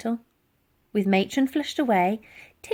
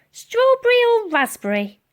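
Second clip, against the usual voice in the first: put-on high, loud cheery old lady; cleaning lady offering the girl jam; 13 semitones higher, 7 dB louder than usual. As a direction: offer it sweetly.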